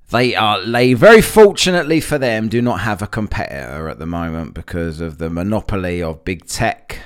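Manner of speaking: ordinary talk, nothing marked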